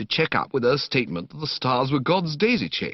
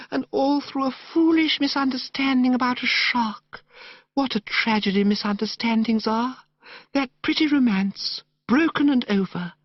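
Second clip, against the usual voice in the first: put-on gushing, dreamy young woman; 13 semitones higher than usual. Narrator voicing Madeline to Bertie in the park, high dreamy gush. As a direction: coo it like a sentimental girl.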